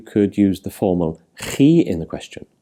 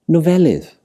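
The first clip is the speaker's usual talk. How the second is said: Nofelydd with "fel" emphasised, higher; stress on second-last syllable; 8 semitones higher, 5 dB louder than usual. Shown as a demonstration. Teacher showing learners Welsh word stress, Nofelydd stressed on "fel". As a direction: hit the middle syllable of Nofelydd. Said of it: "The last syllable but one".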